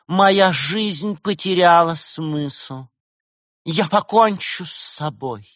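high, slow mournful maiden; tragic girl's voice. A narrator's reading. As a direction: grieve slowly, heightened and theatrical. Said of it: "Девичий голос за кадром". (трагический девичий голос, высоко и медленно)

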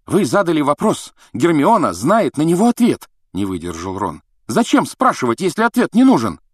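high, loud protesting schoolboy; indignant boy. A narrator's reading. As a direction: burst out indignantly. Ron defends Hermione.

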